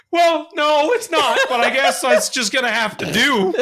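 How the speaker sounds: high, loud mock outburst; exasperated kid. Kid answering Mom about the game, exasperated. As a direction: holler with hammed-up grudging acceptance.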